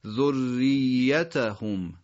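ذریتهم very slowly, both R and Y doubled; double tashdid. Shown as a demonstration. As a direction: hold the doubled R, then the doubled Y in ذریتهم. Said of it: "دو تا تشدید پشت سر هم". (ذریتهم کشیده، با تشدید روی ر و ی)

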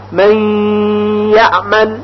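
من یعمل with the nun merged into a held nasal ی; slow recitation, ghunna on ی of یعمل; nasal ghunna demo. Shown as a demonstration. Teacher shows students the ghunna merge.

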